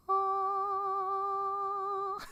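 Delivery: high, sing-song laugh; sung laugh. Real, not acted. Amused at the Barks soda, laughing in a sing-song. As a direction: laugh in a little tune.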